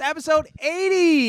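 high, showy announcer call. Acted. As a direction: announce it like a host. Announcing the episode.

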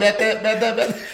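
loud, high amused imitation; robot talking slang. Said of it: "To hear robots say".

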